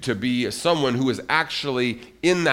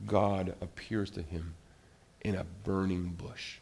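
ordinary delivery

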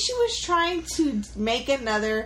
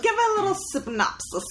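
lightly put-on London accent, higher pitch; 10 semitones higher than usual. Asking co-host for a recap, in a mock London accent. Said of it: "You sound like you're from London".

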